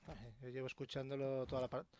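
ordinary delivery

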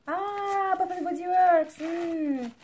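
high, drawn-out delighted squeal of recognition; thrilled, high-pitched "ah" into the name; delighted exclamation. Real, not acted. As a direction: gush over a favourite brand, pitched high. Delighted at opening a haul item.

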